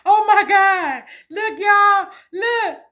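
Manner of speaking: high and loud, laughing through tears; reliving the moment she saw the milestone, high and tearful; overjoyed exclaiming. Retold or imitated. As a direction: call out to the angels in disbelief and joy. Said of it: "I was like".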